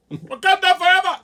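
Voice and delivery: big high shouted Wakanda forever; mock movie war cry; battle cry. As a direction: yell it like a rallying cheer.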